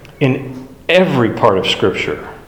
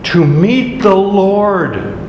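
"To meet the Lord." drawn out slowly, higher, every word weighted; slow emphasis; 6 semitones higher, much slower than usual. Shown as a demonstration. Key phrase of the verse.

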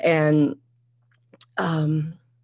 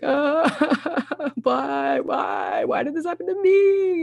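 high, wailing imitation of crying; hammed-up tearful wail; mock sobbing; 11 semitones higher, much slower than usual. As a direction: act out sobbing, voice leaping high. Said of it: "I'm, like, sobbing".

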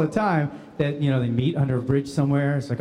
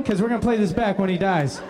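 ordinary talk

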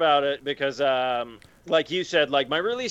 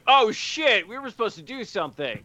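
mock sudden realization; 7 semitones higher than usual. Imitating friends who forgot the plan, mock alarm. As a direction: imitate friends remembering too late. Mocks friends remembering too late.

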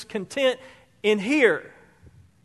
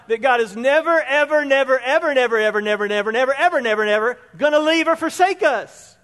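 building never never ever, higher and louder; rising fervour; 4 semitones higher, 5 dB louder than usual. Real, not acted. Pastor to congregation, promising God never leaves, rising fervour. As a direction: preach it, piling up the nevers. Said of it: "giving emphasis".

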